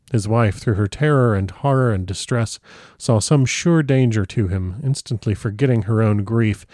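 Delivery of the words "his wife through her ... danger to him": usual speaking voice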